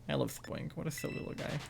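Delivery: soft cooing whisper. Real, not acted. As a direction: breathe it to a cute creature. Cooing at a cute Pokemon.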